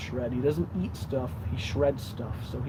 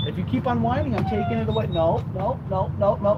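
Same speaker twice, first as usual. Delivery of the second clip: warning the dog; 7 semitones higher, 4 dB louder than usual. Real, not acted. Threatening to take bone.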